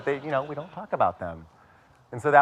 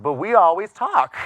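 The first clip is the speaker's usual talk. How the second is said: higher and louder mock comeback; sarcastic retort; 8 semitones higher, 8 dB louder than usual. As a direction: snap it back at mom, mockingly. Retort to mom on phone.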